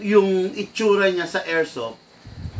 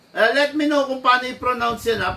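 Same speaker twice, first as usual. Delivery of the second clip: ordinary talk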